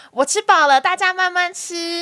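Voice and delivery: put-on sing-song well-behaved child, slow at the end; little girl announcing she is done eating to the family, slow and polite; polite child recital. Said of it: "我不会讲得这么完整啦".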